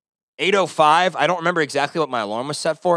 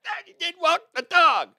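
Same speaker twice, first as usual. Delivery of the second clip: whiny complaint. Retold or imitated. Woman's whiny complaint voiced.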